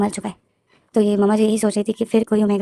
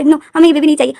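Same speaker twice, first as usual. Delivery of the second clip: put-on high, loud stubborn little boy; whiny child; 6 semitones higher, 8 dB louder, faster than usual. Little boy refusing to take the baby home, loud whine. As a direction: refuse like a spoiled kid.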